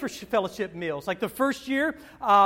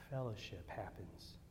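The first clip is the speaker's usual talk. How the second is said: hushed emphasis; 11 semitones lower, 19 dB quieter, slower than usual. Real, not acted. Hushed punchline in sermon.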